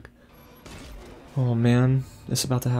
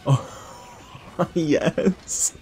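laughing, higher-pitched cheer; real glee breaking into a word; delighted laugh; 7 semitones higher than usual. Real, not acted. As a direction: laugh out a triumphant yes. Delighted at action on screen.

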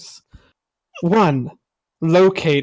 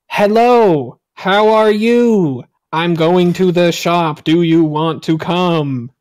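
slow, louder robotic text-to-speech read; Microsoft Sam voice; 5 dB louder, slower than usual. Shown as a demonstration. Demonstrating clear speech to foreigners in a slow, loud robot voice. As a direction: speak each word like an old computer voice, slow and loud. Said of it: "Just talk like Microsoft Sam".

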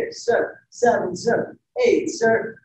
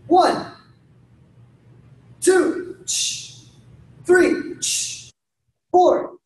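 high, punchy single-word commands; called drill count; 7 semitones higher, much slower than usual. Real, not acted. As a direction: bark each number high and sharp. Calling counts for a blocking drill.

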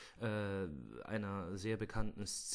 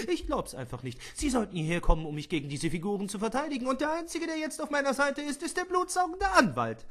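much higher, louder, squeaky complaining voice ending in a laugh; exasperated old man; 14 semitones higher, 7 dB louder than usual. Acted. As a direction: whine it out in a high put-on voice. Hammond beschwert sich lachend. (hohe, laute, gespielte Altmännerstimme)

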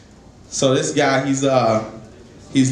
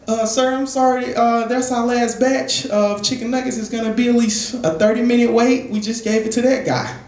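higher-pitched customer service voice; apologetic clerk; 7 semitones higher than usual. Acted. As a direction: break bad news politely in a raised pitch. Plays apologetic fast food clerk.

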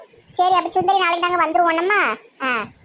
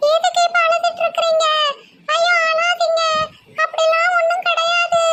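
very high, soothing character voice; consoling young woman; 12 semitones higher than usual. Acted. Munis telling crying Siva not to cry, high-voiced consoling. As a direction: comfort him in a high-pitched voice.

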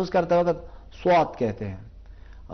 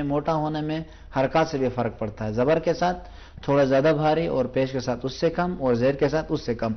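ordinary talk

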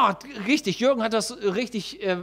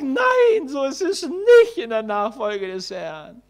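emphatic no; 6 dB louder, slower than usual. Retold or imitated. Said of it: "Und ich sag".

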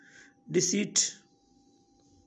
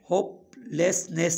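هوپليسنيس slowly as hope, less, ness; syllable by syllable; much slower than usual. Shown as a demonstration. Teacher naming the word for learners, syllable by syllable. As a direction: separate the three parts of هوپليسنيس. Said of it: "ته ان لاءِ توهان هن جا سليبل ٺاهيو".

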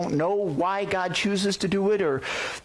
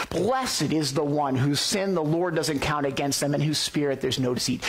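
usual speaking voice

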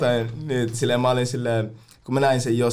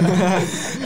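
burst of laughter; 6 semitones higher than usual. Real, not acted. Laughing at the word nakkisoppa, short amused laugh. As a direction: laugh at the silly answer.

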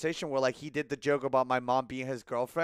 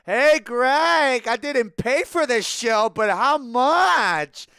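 high, loud catcall to a neighbour; loud, drawn-out mock heckle; teasing holler; 7 semitones higher, 7 dB louder, much slower than usual. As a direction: holler it over, dragging out the last words.

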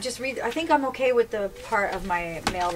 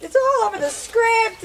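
laughing exasperation; 9 semitones higher, 4 dB louder, slower than usual. Real, not acted. Drink spilled on the script.

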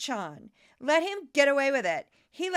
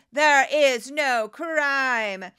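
loud, drawn-out THERE IS NO CRIME; emphatic declaration; 8 dB louder, much slower than usual. Real, not acted. Reading an editorial to reporters, emphatic declaration. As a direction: hammer each word of "There is no crime", slowly.

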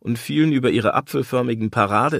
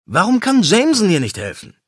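louder, higher complaint with weight on kann; exasperated protest; 9 semitones higher, 7 dB louder than usual. Acted. Lawyer protests to his partner, louder and higher. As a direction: push back hard.

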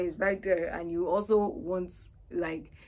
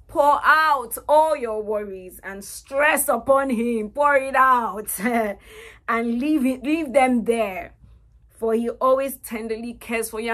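fervent reading; 4 semitones higher, 11 dB louder than usual. Real, not acted. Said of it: "reading scripture with fervor".

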